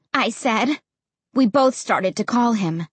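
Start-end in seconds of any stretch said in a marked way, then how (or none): none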